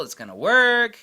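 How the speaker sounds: loud, high-pitched Southern accent. Real, not acted. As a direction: let the Southern accent out on an emphatic promise. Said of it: "That's my Southern accent right there".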